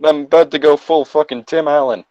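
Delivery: usual speaking voice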